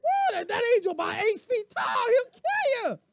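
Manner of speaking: high, loud, frantic donkey voice; panicked donkey. Acted. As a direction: yell the warning as a terrified donkey. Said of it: "this is my interpretation".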